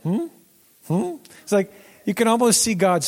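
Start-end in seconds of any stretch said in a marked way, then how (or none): 0.0-1.2 meme hum. Retold or imitated. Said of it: "and people are like".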